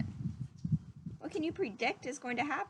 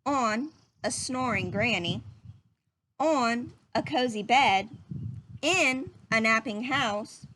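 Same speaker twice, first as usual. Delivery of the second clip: put-on reading to children. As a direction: read it gently to little listeners. Reading picture book to children.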